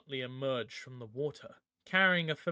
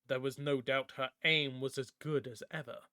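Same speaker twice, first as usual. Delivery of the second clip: plain reading voice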